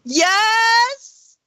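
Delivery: high, loud shouted reply; calling back. Acted. Answering Gav at the top of the stairs, calling back.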